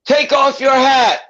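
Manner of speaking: loud, high, drawn-out yell; yelled order. Retold or imitated. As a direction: imitate a court officer yelling an order. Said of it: "the court officer yells".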